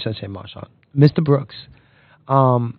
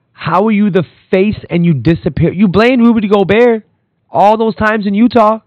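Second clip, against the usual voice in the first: much higher and louder, exasperated; heated callout; 8 semitones higher, 5 dB louder than usual. Real, not acted. Podcaster scolding Donovan Mitchell, heated and loud. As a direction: call him out, fed up.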